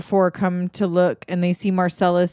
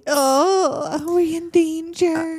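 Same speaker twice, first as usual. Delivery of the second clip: high, slow put-on voice; mock girlfriend; 9 semitones higher, much slower than usual. Girlfriend asks boxer about danger, high slow mock voice. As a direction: imitate her in a drawn-out high pitch.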